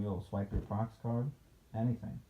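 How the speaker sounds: plain reading voice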